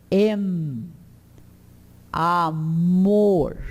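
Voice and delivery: Amor with a firmly closed final m sound. Shown as a demonstration. Teacher showing a closed M in Amor. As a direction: close the lips fully on the M of Amor. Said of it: "O M é sempre fechado".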